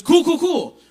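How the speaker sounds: jumps up high and loud, mock eager. The woman eagerly rushes on to her next question, mock eager. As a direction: gush it out like an overeager fan. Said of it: "She goes".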